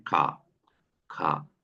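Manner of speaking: "खा" low and slow, clear throat sound; German ka sound. Shown as a demonstration. Teacher models the letter for the student. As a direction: say "खा" deep and deliberate. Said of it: "उसे हम क्या बोल रहे हैं".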